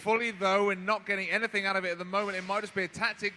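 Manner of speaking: shouted high-energy fight commentary; live play-by-play. Real, not acted. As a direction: yell the action as it happens.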